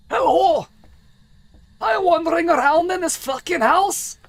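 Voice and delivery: loud, high-pitched hoarse dwarf bellow, drawn out; hoarse throaty dwarf. Shown as a demonstration. Dwarf demanding a ghost show itself, hoarse throaty bellow. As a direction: push it from the throat like a roaring dwarf. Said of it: "deeper in my throat and be a bit hoarse".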